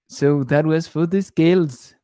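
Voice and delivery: lightly put-on silly Indian cartoon accent; a playful touch of an Apu-like Indian accent. Wrapping up the scales topic for viewers, in a silly accent. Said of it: "I don't know why I do this silly accent".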